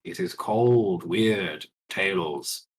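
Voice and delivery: low, slow dramatic radio host; radio announcer. Acted. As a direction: intone it like a spooky radio show. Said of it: "over the radio".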